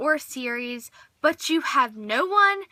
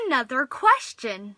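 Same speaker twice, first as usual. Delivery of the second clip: usual speaking voice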